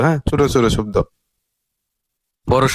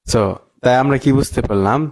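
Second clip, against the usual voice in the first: usual speaking voice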